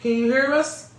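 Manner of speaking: cheerful host hailing a caller on the phone; calling out to caller. Real, not acted. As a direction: call out over the line to be heard. Greeting a radio caller.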